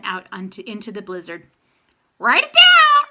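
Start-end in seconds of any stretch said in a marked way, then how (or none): 2.2-3.1 very high, loud squawking raven voice; raven yell. A narrator's reading. Blue Sky the raven yelling from the roof. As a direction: screech it like a raven. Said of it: "Blue Sky yelled".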